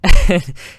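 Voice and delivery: high, loud burst of laughter; menacing laugh. Retold or imitated. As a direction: laugh like a thug about to fight. Angry teacher laughs menacingly.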